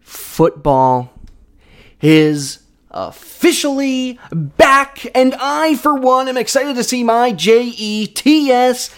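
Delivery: high, slow stadium-announcer build to a Jets chant; announcer hype. Acted. Hyped football season opener.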